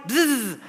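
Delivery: drawn-out imitated machine buzz; food processor buzz. Miming the word for food processor, long comic buzz. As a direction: buzz slowly like a blender.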